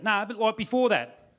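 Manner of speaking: put-on Aussie questioner; Australian punter. Imitating a persistent Australian questioner.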